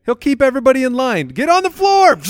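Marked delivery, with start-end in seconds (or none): 1.3-2.2 high mock-threatening order; mock command. Pikachu's owner acting out keeping bank guards in line, mock command. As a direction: play at ordering everyone down, high-pitched.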